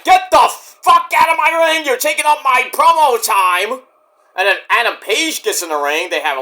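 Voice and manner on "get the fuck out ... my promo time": angry yell. Retold or imitated. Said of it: "M J's like".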